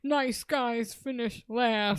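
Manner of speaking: slow, drawn-out mock self-pity; mock sulking. Podcaster voicing a friend's lament after rejection, mock sulking. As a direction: drag it out like a rejected nice guy.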